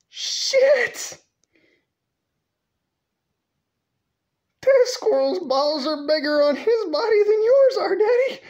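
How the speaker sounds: put-on a boy yelling the joke at his dad; high shouting kid. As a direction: blurt it out in a high yelp. Kid bursting out, shout cry.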